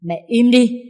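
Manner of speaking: loud, high cutting retort; sharp outburst. A narrator's reading. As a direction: snap at her mother-in-law. Wife finally snaps at mother-in-law. (quát mẹ chồng)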